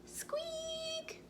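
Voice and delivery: tiny high squeaky mouse noise; mouse squeak. Acted.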